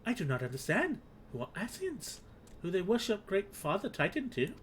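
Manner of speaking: high, questioning little creature voice; puzzled kobold. Acted. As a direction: ask as a confused kobold.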